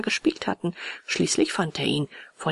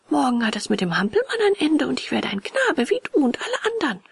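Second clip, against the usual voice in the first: put-on higher-pitched boy puppet, full of news; eager puppet boy; 7 semitones higher than usual. A narrator's reading. Puppet tells friend he'll become a boy, eager high voice. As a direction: announce it proudly in a raised boy's voice.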